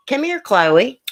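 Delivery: high, sing-out call to a dog. Real, not acted. Calls her whining dog mid-stream.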